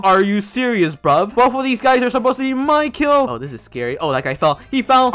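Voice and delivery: usual speaking voice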